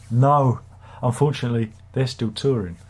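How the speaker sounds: ordinary delivery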